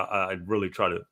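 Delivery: ordinary talk, nothing marked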